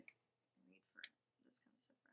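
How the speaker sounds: barely audible, swallowed mumble; mumbling. Real, not acted. Reader defending her long poems, mumbled under her breath. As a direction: trail off into an unintelligible mumble. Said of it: "You mumbled".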